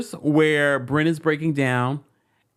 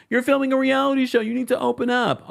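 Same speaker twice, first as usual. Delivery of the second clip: higher, fast, mock scolding; mocking viewer voice; 11 semitones higher than usual. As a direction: parrot the critics in a high mocking voice. Voicing imagined viewers' objection.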